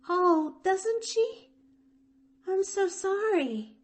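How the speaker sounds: put-on high, bright child voice; young girl's voice, pitched high; eager little girl. A narrator's reading. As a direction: read it as a hopeful young girl. Pollyanna voiced by narrator.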